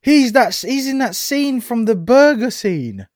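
real astonished outburst; shocked recognition. Real, not acted. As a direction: yell it as it suddenly clicks.

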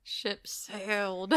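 normal talking voice

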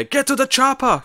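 high, slow Arnold catchphrase; Arnold impression. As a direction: bark the famous line like Arnie, drawn out. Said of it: "this is the movie where".

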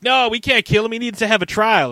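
high, loud objection; heated protest. Retold or imitated. Acted argument between Anakin and Windu.

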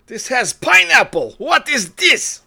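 high, outraged mock Russian accent. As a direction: complain slowly in a hammed-up Russian accent. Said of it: "trying to do a Russian accent".

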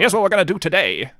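put-on quick old-time announcer patter; fast vintage radio voice; nineteen-twenties radio announcer. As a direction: rattle it off like a twenties newsman. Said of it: "like, the nineteen twenties, like, the really fast".

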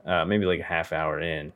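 ordinary talk

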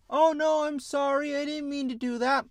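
high-pitched, hammed-up whiny sorry; mock apology. Mocking Superboy's fake apology, hammed-up high whine. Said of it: "Superboy's like".

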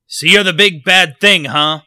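loud, high mocking challenge; tough guy taunt. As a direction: taunt a giant like a cocky bruiser. Voicing the tough guy in crowd.

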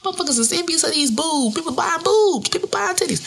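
high, playful bragging; boastful exclaiming. Acted. Bragging to listeners about her body, playfully boastful.

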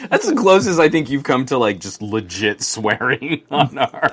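talking through laughter; laughing while he teases. Real, not acted. Laughing at near-swearing.

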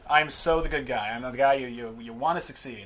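ordinary talk, nothing marked

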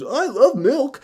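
put-on high, childlike squeak of delight; dopey Josh. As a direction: chirp it up high like a happy kid. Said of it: "And Josh goes".

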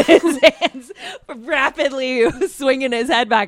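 loud, high words bursting through laughter; laughing while talking. Real, not acted. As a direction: crack up mid-description. Cracking up describing the scene.

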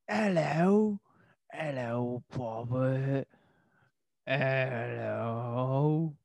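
lightly voiced echoing jungle call; a hint of calling out from the jungle; jungle explorer parody. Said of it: "just do this episode in the jungle".

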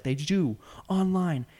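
put-on a high, clipped New York accent. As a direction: imitate a short New York guy's snap. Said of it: "in the most, like, New York accent possible".

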